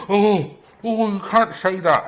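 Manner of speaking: mock gasp. Mocking the shocked objector with a gasp. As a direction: parody a scandalised objector.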